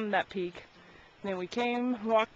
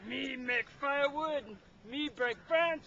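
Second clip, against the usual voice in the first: caveman voice; 9 dB quieter than usual. Pretend. Caveman talk about firewood.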